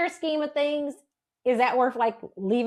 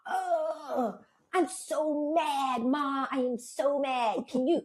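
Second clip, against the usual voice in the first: imitated fuming; 3 semitones higher, much slower than usual. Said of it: "I would be mad about something".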